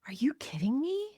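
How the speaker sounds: breathless, unvoiced disbelief; stunned whisper. Real, not acted. Hearing about the punches, a shocked whisper. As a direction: whisper it, floored by the story.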